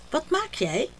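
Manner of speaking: put-on high, eager little girl asking; girl's voice. A narrator's reading. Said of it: "vraagt Dida". (hoog kinderstemmetje, nieuwsgierig)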